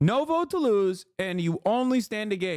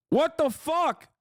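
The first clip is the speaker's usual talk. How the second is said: exasperated outburst; 9 semitones higher, slower than usual. Real, not acted. Streamer reacting to a chat comment, exasperated. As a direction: blurt it out in disbelief.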